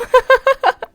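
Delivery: very high, loud burst of laughter; loud cackling laugh. Real, not acted. Player reacting to the game, loud cackle.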